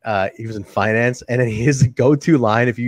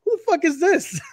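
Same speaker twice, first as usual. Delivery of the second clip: a much higher, strained voice, hammed-up indignation; mock outraged shriek; 5 dB louder than usual. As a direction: squeal it like an offended cartoon, far above his own pitch. Mock outraged reply to the coworker.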